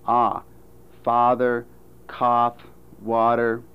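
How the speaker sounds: "father", "cough", "water" all with one in-between vowel; merged ah and aw. Shown as a demonstration. Phonetician showing American merger, "father, cough, water" sharing one vowel. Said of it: "are pronounced with the same vowel, like this".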